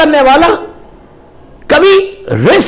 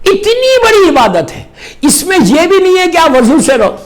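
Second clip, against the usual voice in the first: ordinary talk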